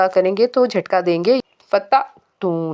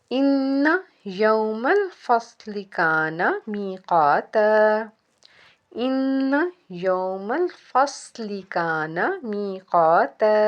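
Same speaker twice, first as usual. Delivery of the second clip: slow, measured Quranic recitation with drawn-out vowels; teacher's careful tajweed reading; 3 dB quieter, much slower than usual. Shown as a demonstration. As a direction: recite slowly, holding each long sound. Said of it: "इनको हम तजवीद के साथ पढ़ते हैं".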